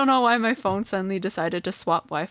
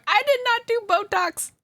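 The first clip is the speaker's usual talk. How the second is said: indignant denial; 4 dB louder than usual. Real, not acted. Denying Botox with a laugh.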